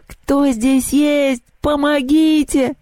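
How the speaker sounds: high, slow, desperate cry; frantic scream for help. A narrator's reading. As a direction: call out in terror from a window. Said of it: "раздался истошный крик". (истошный крик о помощи)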